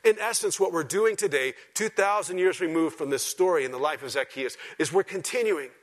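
loud, high preaching voice; full-force preaching. Real, not acted. Preacher explaining Scripture to his church, preaching at full force.

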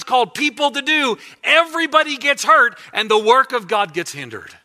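loud, high preaching build; preaching at full force. Acted. Pastor exhorting his congregation, preaching at full force. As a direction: drive it home to the congregation, full voice.

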